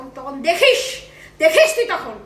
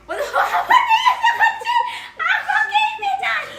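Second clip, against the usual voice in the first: much higher, laughing through the words; 13 semitones higher than usual. Real, not acted. Owner laughs that the dog snaps at her, giggling high. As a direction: giggle out the complaint.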